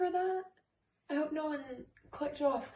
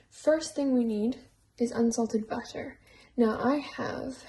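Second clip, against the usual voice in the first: lightly put-on, horrible Irish accent; mock Irish lilt, half-hearted; bad Irish accent; 8 dB louder than usual. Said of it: "stop talking in my horrible Irish accent".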